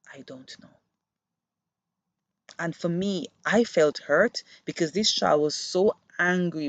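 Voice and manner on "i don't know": whisper. Retold or imitated. Angry child answers teacher.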